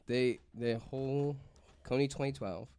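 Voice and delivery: normal talking voice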